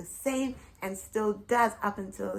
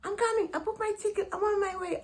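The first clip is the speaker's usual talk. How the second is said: excited mother; 11 semitones higher, faster than usual. Retold or imitated. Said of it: "she says".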